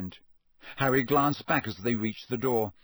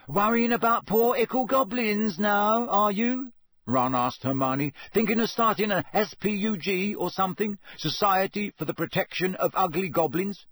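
mocking tease; 8 semitones higher, 3 dB louder than usual. Ron teasing Hermione.

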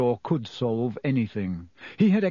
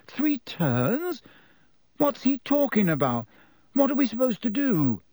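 high, confused questions; totally lost and alarmed; bewildered; 10 semitones higher than usual. Acted. Harry baffled and alarmed.

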